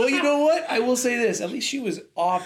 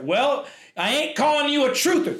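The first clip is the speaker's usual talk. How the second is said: pitched-up quoted retort; quoted show line; 8 semitones higher than usual. Retold or imitated. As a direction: deliver it like a TV line. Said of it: "it's from a show".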